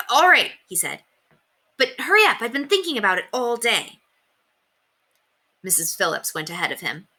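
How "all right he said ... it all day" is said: put-on impatient boy. A narrator's reading.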